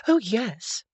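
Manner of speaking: chirpy. A narrator's reading. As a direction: chirp it up brightly. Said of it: "she chirped up with the truth".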